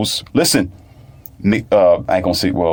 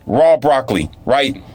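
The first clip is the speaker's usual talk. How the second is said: ordinary talk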